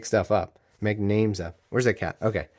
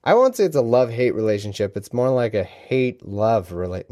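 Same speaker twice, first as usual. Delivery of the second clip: ordinary delivery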